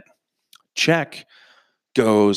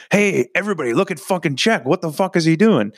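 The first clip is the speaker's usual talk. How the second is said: high mock call to the whole room; 8 semitones higher than usual. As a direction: yell it across the party, teasing. Calling out to party about friend.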